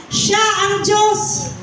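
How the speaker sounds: slow, high-pitched declaration; rallying proclamation. Shown as a demonstration. Preacher prompts each listener to repeat it, rallying proclamation. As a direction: lead a crowd chant, drawn out and high. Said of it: "Isa pa, sabihin mo sa sarili mo".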